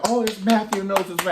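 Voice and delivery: hammed-up crowd excitement; mock excited crowd. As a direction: squeal it like a starstruck crowd. Mock crowd announcing Matthew Knowles.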